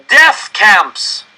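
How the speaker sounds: high, very loud cry; anguished cry. Acted. Crowd member crying out at the director, anguished. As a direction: cry it out in horror. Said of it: "another person cried".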